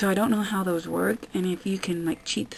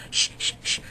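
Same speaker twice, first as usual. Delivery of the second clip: quieter whispered mock shush; 5 dB quieter than usual. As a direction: shush softly, mocking. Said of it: "It's annoying".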